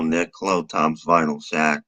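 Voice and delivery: normal talking voice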